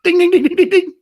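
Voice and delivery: very high, loud rapid dinging; high-pitched "ding ding" noise; imitated alarm bell. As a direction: ring out like a bell. Mimics a bell at the report.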